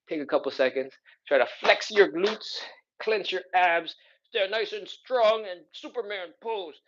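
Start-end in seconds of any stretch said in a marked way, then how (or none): 1.3-6.8 high, slow mock-heroic coaching; jokey. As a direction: stretch it out in a jokey, high superhero push. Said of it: "I'm kinda jokey".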